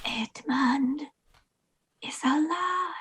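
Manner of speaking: breathy whisper, leaning in close; secret whisper. Acted. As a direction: whisper it so no spy hears. Secret news told to soldier.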